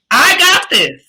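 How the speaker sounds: high, punchy declaration of belief; with umph. Shown as a demonstration. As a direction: put some umph into it. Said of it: "put some umph into it".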